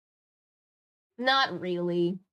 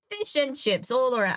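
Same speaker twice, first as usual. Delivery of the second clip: higher, badly faked English waiter accent; attempted English accent; 6 semitones higher, 4 dB louder than usual. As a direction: serve it up in would-be English. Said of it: "I'm trying to do an accent".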